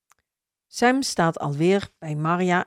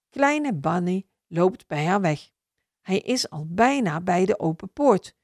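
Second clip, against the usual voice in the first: ordinary delivery, nothing marked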